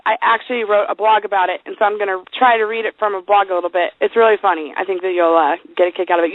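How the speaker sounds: normal talking voice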